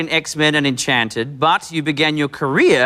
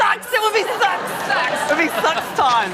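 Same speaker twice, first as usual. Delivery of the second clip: high mock Kiwi accent. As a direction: say it like a New Zealander, hammed up. Mocking Kiwi pronunciation of six.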